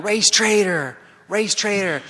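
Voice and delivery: high but half-hearted imitation of a yelled chant; mock yelling. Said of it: "So they're yelling".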